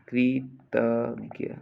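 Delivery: slow, separated कृतज्ञ; syllable by syllable. Shown as a demonstration. Teacher dictating to students, कृतज्ञ slowly in syllables. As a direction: space out "kri, tag, ya" in कृतज्ञ.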